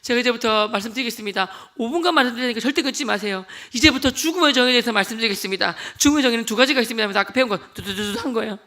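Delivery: loud fiery preaching building to a shout. Retold or imitated. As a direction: preach hard to the whole family. Preaching to beating relatives. (온 가족 앞에서 뜨겁게 설교)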